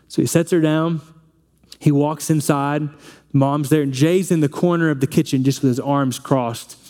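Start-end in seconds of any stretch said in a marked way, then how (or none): none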